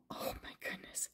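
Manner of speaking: hushed, breathy aside; a surprised secret whisper to the children. A narrator's reading. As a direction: lean in and whisper it.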